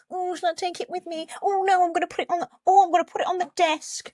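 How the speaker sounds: put-on high, fast little kid voice; squeaky child playing with a toy. Kid in the film deciding where the Power Ranger goes. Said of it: "and he was like".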